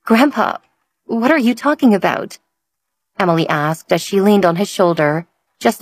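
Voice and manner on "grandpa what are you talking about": puzzled. Acted. Granddaughter puzzled by grandfather.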